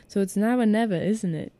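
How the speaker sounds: put-on amateur British accent, dropped r in never. Ron answering Harry in a reread, British accent. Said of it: "if I could do a British accent".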